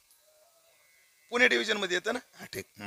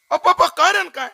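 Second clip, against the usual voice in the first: surprised plea; 12 semitones higher, 6 dB louder than usual. Acted. Son pleads with father.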